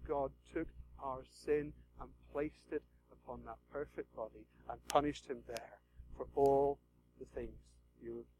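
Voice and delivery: soft, low, intimate preaching; hushed sermon. Real, not acted. Preacher to hushed congregation, soft and intimate. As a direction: draw them in quietly.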